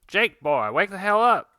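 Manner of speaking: loud, high call to wake a sleeper; shouting to wake him. Acted. A character shouting at sleeping Jake to wake up, loud and high. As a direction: yell at him to get up.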